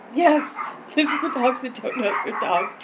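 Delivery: normal talking voice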